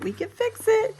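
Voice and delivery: cheery reassurance. Real, not acted. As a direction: lift it up cheerfully. Repeats reassurance, brighter.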